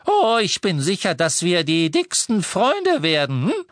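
high, chirpy and breezy; cheerful. A narrator's reading. Dedalus cheerily reassuring Harry while leaving, high chirpy voice. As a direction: brightly dismiss the worry. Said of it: "sagte Dickel munter".

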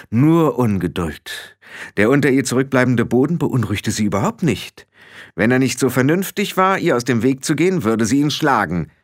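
plain reading voice

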